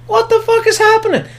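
exasperated at the movie; shrieked disbelief. Real, not acted. Ranting about the movie.

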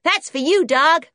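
put-on high, loud kid; brash American boy. A narrator's reading. Junior throwing cake to Timmy, brash and loud. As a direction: fling it at the dog boldly.

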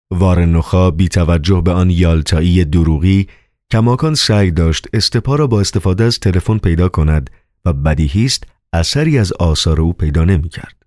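ordinary delivery, nothing marked

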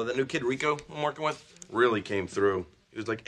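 usual speaking voice

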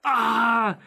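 sharp high yelp of pain; retold yelp as the foot hits the floor. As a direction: cry out as the foot touches down. Putting the sore foot down.

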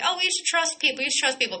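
high, sing-song mimicry of naive advice; mocking advice. Naysayers telling her to trust people, mocking imitation. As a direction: parrot it back in a high mocking voice.

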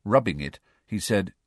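ordinary delivery, nothing marked

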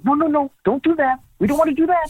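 high-pitched, frantic no no no; panicked plea. Retold or imitated. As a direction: voice jumping way up in alarm to stop her. Panicked stopping of a prayer.

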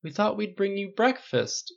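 high, slow pleading excuse; timid excuse. A narrator's reading. Riley pleads as Flynn rages.

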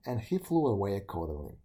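ordinary delivery

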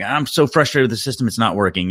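frustrated customer. Retold or imitated. Customer calls the installer to complain about the system, mock frustrated. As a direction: voice a caller's exasperation. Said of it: "he called us up and he's like".

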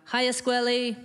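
a hint of camp sing-song hello; imitating the owner. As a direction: lightly mimic a flirty restaurant owner. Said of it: "why doesn't my voice sound like that".

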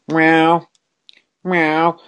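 high mock meow; imitated meow. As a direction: meow like the cat begging. Imitating cat meowing.

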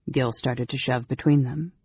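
plain reading voice

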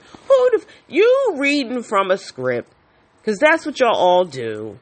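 fed up and loud, calling out the script; exasperated outburst. Real, not acted. Patient venting at surgeon, high loud indignation.

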